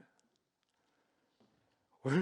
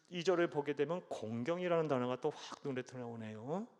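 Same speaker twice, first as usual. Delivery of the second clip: usual speaking voice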